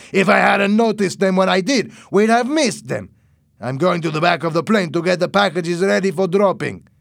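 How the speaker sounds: loud, high-pitched boss, worked up; irritated smuggler boss. A narrator's reading. Boss to pilot on spotting fishing boats, irritated. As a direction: scold at high pitch and full voice.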